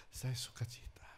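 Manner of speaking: soft unvoiced whisper of joy. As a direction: breathe it out quietly. (bisikan lembut penuh sukacita)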